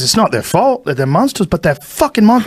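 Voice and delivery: mock Australian building up high; exaggerated Australian rant; Adam Green impression. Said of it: "worst Adam Green impression".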